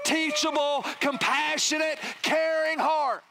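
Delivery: high, ringing sermon delivery; impassioned preaching. Real, not acted. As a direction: drive each phrase home, stretching the key words. Preaches at raised pitch.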